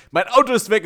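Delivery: high, loud mock panic; retold alarmed outburst; panicked claim. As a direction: blurt it out in alarm. Retelling panicked claim at reception.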